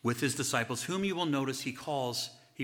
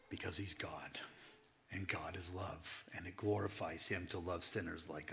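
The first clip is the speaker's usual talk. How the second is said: tender whisper; 4 semitones lower, 6 dB quieter than usual. Acted. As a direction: say it gently, almost to yourself. Soft whispered answer.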